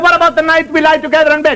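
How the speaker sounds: high hammed-up Italian wife. Wife calling after her husband, high comic Italian accent. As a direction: plead like a comic Italian mamma. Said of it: "She said".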